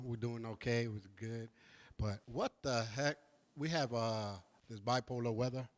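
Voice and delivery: usual speaking voice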